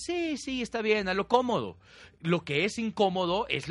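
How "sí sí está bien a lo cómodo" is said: high, sing-song mock agreement; mock lazy giving in. You giving in to comfort, mock high voice. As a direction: play the lazy self saying yes to comfort. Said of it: "Porque dices".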